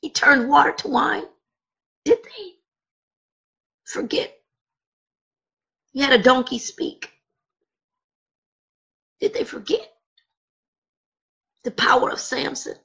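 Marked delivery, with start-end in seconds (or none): 2.0-4.3 a whispered rhetorical question; hushed whisper. Acted. Sudden hush in the litany. 9.2-12.8 a whispered rhetorical question; hushed whisper. Acted. Continuing hushed litany.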